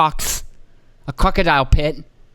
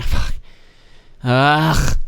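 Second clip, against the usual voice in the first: normal talking voice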